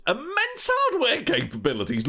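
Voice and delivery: incredulous scoff, high. Acted. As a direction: scoff at the idea. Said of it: "Dan scoffed".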